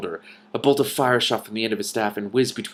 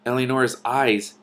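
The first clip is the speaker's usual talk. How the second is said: plain reading voice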